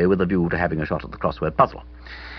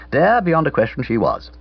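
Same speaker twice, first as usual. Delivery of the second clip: plain reading voice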